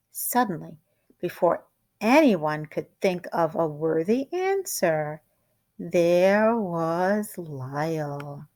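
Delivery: usual speaking voice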